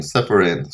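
ordinary delivery

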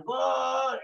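high goal shout; shouting gol. Shown as a demonstration. Teacher demonstrates jumping fans shouting goal. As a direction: cheer it out. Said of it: "Vamos a saltar".

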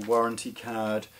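ordinary talk, nothing marked

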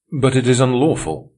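put-on young oligarch protesting his capture, loud and indignant. A narrator's reading. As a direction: object sharply, affronted. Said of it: "was the protest".

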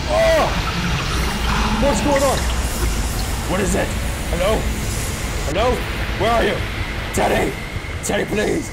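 high, loud frightened cries; scared shouts searching for the lost teddy; frightened calling. Real, not acted. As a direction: call out in fear for Teddy.

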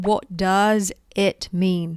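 "What does it mean" said slowly, word by word; slow correct model. Shown as a demonstration. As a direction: say each word apart, slowly. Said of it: "Actually, you need to say". (พูดช้าๆ ทีละคำ)

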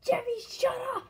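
high, loud shout of Jeffy's name; angry shout. Acted. Fed-up dad shouting at annoying Jeffy, loud and high. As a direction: yell at him to shut up.

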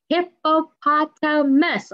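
slow, high, each syllable apart. Shown as a demonstration. Teacher to kids, counting syllables, slow and spaced. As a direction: spell out hip-po-pot-a-mus slowly. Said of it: "how many sounds our final animal".

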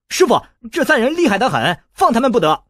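high, loud anxious warning; alarmed urging. A narrator's reading. An anxious boy warns his master not to free the enemies, high alarmed urging. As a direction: urge the master to stop. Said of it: "韦小宝大急".

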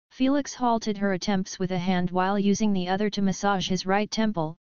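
plain reading voice

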